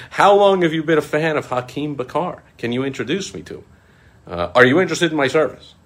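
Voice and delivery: high, loud mock-eager questioning. Teasing the scammer, high and loud mock eagerness. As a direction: pepper them with fake interest.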